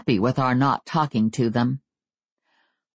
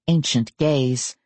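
ordinary delivery, nothing marked

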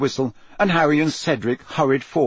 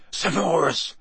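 usual speaking voice

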